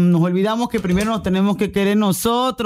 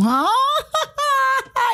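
high laugh. Real, not acted. Laughs at her own joke.

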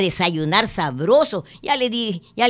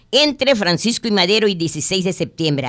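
ordinary talk

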